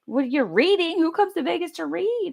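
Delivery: high, puzzled mock voice of a stranger; incredulous strangers. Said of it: "People are like".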